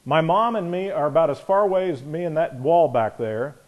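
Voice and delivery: normal talking voice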